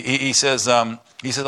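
ordinary delivery

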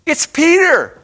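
high, loud joyful cry; excited shout. Retold or imitated. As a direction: blurt it out thrilled. Rhoda announcing Peter.

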